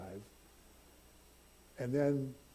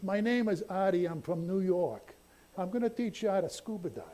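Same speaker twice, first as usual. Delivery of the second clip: higher, lightly voiced New York cab driver accent; 7 semitones higher than usual. Preacher imitating a New York dive instructor, light New York accent. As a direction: mimic a brash New Yorker introducing himself. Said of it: "he talks like this".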